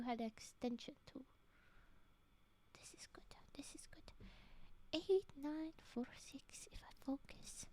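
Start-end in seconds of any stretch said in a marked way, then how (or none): 2.7-7.7 soft unvoiced whisper to herself. Real, not acted. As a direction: whisper it under your breath. Muttering while choosing a story.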